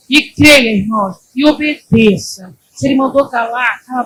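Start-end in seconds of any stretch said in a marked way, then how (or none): none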